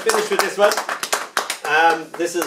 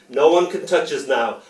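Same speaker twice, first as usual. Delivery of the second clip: normal talking voice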